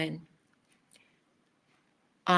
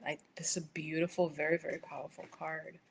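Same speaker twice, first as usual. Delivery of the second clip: whisper; 6 dB quieter than usual. Real, not acted. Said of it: "whispered awe at card".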